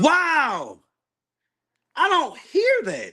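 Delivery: very high-pitched, drawn-out disbelief; incredulous. Real, not acted. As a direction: protest slowly in high, real astonishment. Shocked by own recorded voice.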